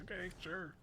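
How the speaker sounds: sarcastic. Retold or imitated. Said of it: "and I was like".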